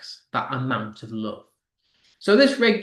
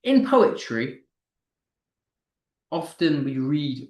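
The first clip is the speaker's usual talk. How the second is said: ordinary talk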